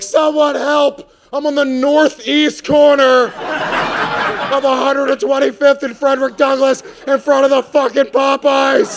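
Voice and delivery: high, slow, drawn-out yell for help; mock distress call spelling out the address; hammed-up woman screaming her exact location. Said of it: "She should have been like".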